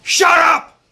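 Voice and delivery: sudden, very loud high-pitched yell; full-voiced imitated scream. Sales trainer startling his hushed seminar audience, sudden scream. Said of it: "And then he screamed".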